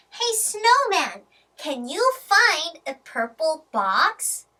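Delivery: ordinary talk, nothing marked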